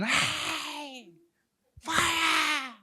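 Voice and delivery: high, loud drawn-out mock shriek; imitated scream. Woman screaming at the fire crew, imitated high scream. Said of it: "woman screaming".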